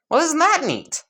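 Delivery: high, sing-out cheerful remark; chirpy delight. Acted. Izuku learns caterpillars eat beans, chirpy delight. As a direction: pipe it up high, pleased as a child.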